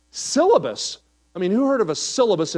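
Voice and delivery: syllabus? naik tinggi dan keras; incredulous. Real, not acted. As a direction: ucapkan "syllabus?" dengan tak percaya, keras dan tinggi. Incredulous reaction to word.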